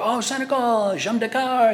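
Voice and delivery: bright high French greeting; light up as you greet him in French; delighted greeting. Retold or imitated. A passenger greets a cab driver from Senegal, delighted.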